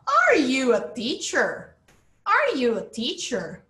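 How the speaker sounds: deliberate teacher's model of "Are you a teacher"; question intonation. Shown as a demonstration. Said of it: "olha a entonação lá em cima".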